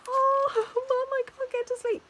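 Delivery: high-pitched whimpering imitation; mock crying. Daughter tapping mum on the plane, mock crying. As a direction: whine it like a tearful daughter, hammed-up. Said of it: "and then crying and saying".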